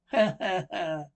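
loud staccato ha-ha-ha; acted heckler's laugh; mocking laugh. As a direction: burst out laughing at your joke. Laugh after spirit of spring joke.

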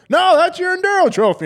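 squeaky imitation of the builder; high mock voice. Retold builder's reply.

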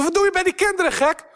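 hoge, harde beschuldiging; schreeuw. Retold or imitated. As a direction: roep hem woedend toe. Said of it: "Ik zo". (boos roepen naar de vreemde man)

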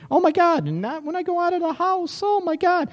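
very high squeaky put-on female voice; mock fussy woman. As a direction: imitate a fretting woman in falsetto. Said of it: "I almost hurt myself with that voice".

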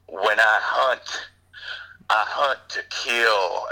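slow, deliberate action-hero delivery; drawn-out, imitated tough-guy catchphrase; movie hero one-liner. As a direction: read it like a big movie line, pausing midway. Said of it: "I gotta get the line".

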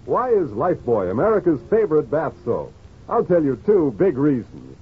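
usual speaking voice

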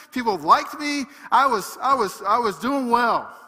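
loud, high, exasperated lament; despairing Joseph. Acted. Preacher voicing Joseph giving up in prison, loud high lament. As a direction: cry it out like a man who has had enough. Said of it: "how he could have been just like".

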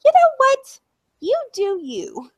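high, mocking mom impression. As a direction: parrot mom in a high sing-song. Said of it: "my mom's like".

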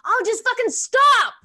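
high, loud, slow angry snap. Retold or imitated. A mum snapping at her panicking daughter, loud and angry. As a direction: bite it off in pure frustration. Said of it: "And I was like".

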